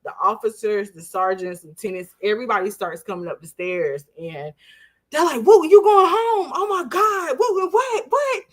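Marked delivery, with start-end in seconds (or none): 5.4-8.4 high, disbelieving excited cries; pitched-up, overexcited clamor; mock crowd screaming. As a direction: imitate friends shrieking in amazement. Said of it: "everybody starts screaming with me".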